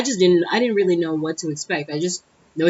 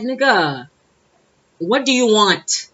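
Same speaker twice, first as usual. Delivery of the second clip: louder, higher, drawn-out demand; exasperated outburst; 4 semitones higher, 5 dB louder, slower than usual. Retold or imitated. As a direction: let the impatience burst out on the question. Imagined outburst, "I don't say that.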